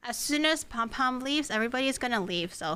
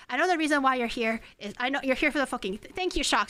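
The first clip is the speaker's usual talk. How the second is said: ordinary talk, nothing marked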